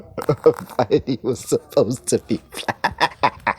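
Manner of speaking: slow, laughing through each word; can't keep a straight face saying it; laughing in disbelief. Real, not acted. Vlogger repeating Rowling's claim, slowly laughing through it.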